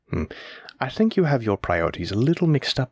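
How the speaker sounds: ordinary talk, nothing marked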